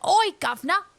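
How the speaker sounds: high-pitched Cockney character voice; Cockney accent. Acted. Cockney villager advising on a campfire, high Cockney accent. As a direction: play a chirpy Cockney, pitched well up. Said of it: "He's got a Cockney accent".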